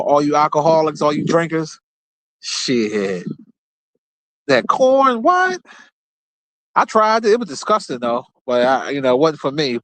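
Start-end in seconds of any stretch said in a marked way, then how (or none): 4.5-5.6 shoots up high in mock shock; disbelieving. Real, not acted. Telling viewers about corn liquor, high and incredulous. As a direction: act floored by it.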